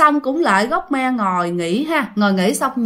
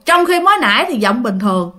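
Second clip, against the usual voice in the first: plain reading voice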